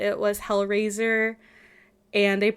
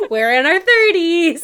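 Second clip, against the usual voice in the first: laughing; 7 semitones higher, 8 dB louder than usual. Real, not acted. Laughing protest.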